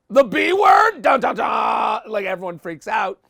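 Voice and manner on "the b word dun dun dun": high, loud shout, then slow "dun dun dun"; mock dramatic alarm. Housemates freaking out over the accusation, mock dramatic shout. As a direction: ham up the soap-opera sting. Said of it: "And they're like".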